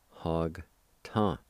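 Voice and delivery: "Hog ta" with a short gap between syllables; careful two-part "Hog ta"; measured syllable demonstration. Shown as a demonstration. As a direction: lightly separate "Hog" from "ta". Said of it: "pronounce the G sound with the H A like this".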